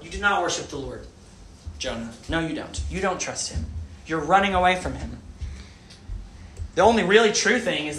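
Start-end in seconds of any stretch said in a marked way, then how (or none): none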